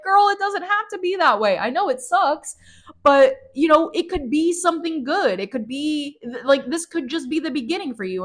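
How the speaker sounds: high, gentle coaxing voice; soothing Charlotte. Retold or imitated. Charlotte comforts Alice, high gentle coaxing. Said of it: "Charlotte's like".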